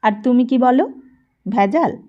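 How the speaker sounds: ordinary delivery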